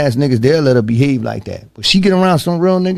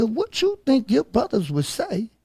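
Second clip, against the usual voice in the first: stern confrontation; 8 semitones higher, 5 dB quieter than usual. Retold or imitated. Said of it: "I said to her".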